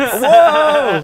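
high, loud drawn-out cheer; excited whoop. Real, not acted. Friend reacting to [name] spider tickets, excited whoop. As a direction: whoop in amazement.

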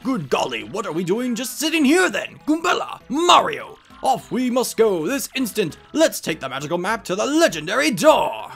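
loud, high rallying cry; rally the party to leave this instant; impatient, excited professor. Acted.

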